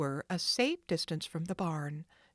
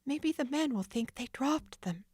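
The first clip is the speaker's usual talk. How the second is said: put-on high, hopeful girl's voice; Nancy hoping; 8 semitones higher than usual. Nancy hoping the thieves are fooled. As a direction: reassure herself.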